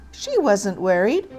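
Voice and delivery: usual speaking voice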